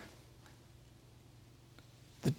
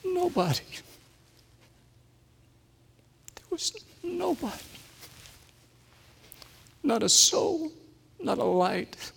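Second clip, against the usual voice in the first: voice breaking with emotion; 12 semitones higher, 5 dB quieter than usual. Real, not acted. Alone on a dark roadside.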